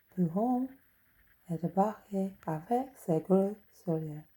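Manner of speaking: hammed-up French storyteller voice; mock French reading. Shown as a demonstration. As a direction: read it like a French tale to a child. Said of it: "I'll read some French to you".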